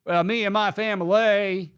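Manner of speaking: mock the coach's phony Southern twang; Brian Kelly. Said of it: "you didn't even remotely sound like that".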